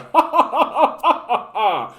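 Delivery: short high laugh at his own bit; scornful chuckle; mocking laugh. Real, not acted. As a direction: laugh off the absurdity.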